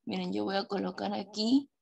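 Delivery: ordinary talk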